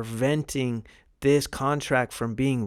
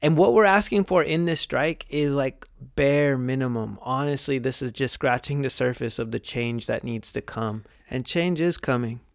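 usual speaking voice

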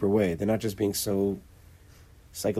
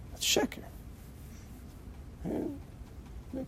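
That's sheker breathed out as a whisper, softer; 14 dB quieter, much slower than usual. Shown as a demonstration. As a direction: whisper the repeat to drive it home.

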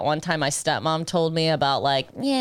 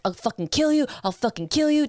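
raised, higher furious threat; mock threat; 7 semitones higher than usual. To her boyfriend after being slammed, higher mock threat. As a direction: threaten him like you mean it. Threatening abuser in retold fight.

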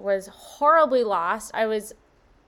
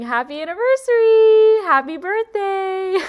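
much higher and louder, bursting with joy; gleeful spirit voice; 10 semitones higher, 10 dB louder than usual. Retold or imitated. Voicing Spirit's joyful greeting to her, a high excited cheer. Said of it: "Spirit was definitely saying".

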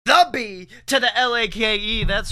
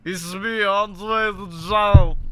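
put-on Andre the Giant accent; slower than usual. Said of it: "Andre had, like, a weirder accent".

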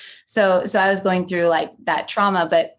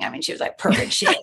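breathless whisper of admiration; hushed, awed aside. Real, not acted. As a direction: lean in and whisper it. Gushing aside about her double.

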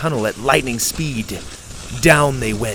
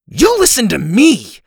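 loud and high, furious; furious shout; 10 semitones higher, 8 dB louder than usual. A narrator's reading. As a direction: yell it in her face. Laney furious, grabs collar.

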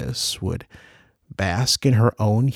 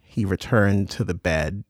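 plain reading voice

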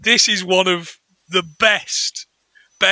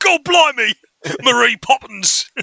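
higher-pitched hammed-up Cockney; mock Cockney; 4 semitones higher than usual. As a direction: joke Cockney exclamation through laughter. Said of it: "But yeah, Cockney".

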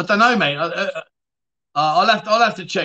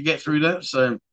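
ordinary talk

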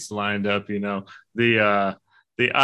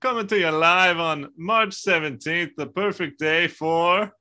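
Brave impression; 9 semitones higher than usual. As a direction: proclaim it in a hammy Highland lilt. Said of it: "doing a Brave impersonation".

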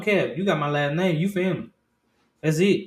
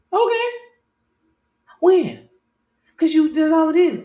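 much higher and louder, eager and quick; eager curiosity; 14 semitones higher, 9 dB louder than usual. Retold or imitated. A girl comes out to him, he answers high and excited.

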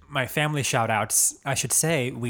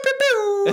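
high, louder air-horn pews; imitated DJ pew; 6 dB louder than usual. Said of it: "He was always the pew".

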